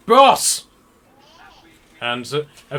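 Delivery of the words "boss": loud call across the deck, a high shout; guard shouting for boss. Acted. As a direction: yell out for the boss. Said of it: "turns around and is like".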